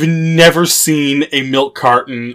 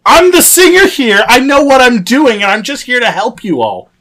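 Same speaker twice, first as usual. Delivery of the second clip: high, bossy know-it-all voice; mocking bossy diva; 10 semitones higher, 3 dB louder than usual. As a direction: squeal it like a self-appointed lead singer. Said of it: "being like".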